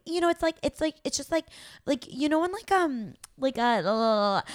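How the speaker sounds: high, stumbling filler-word babble; rambling self-mockery. Podcaster imitating her own recorded rambling, high and dithering. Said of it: "I went on and on and on".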